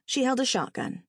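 usual speaking voice

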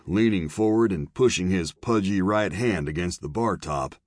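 plain reading voice

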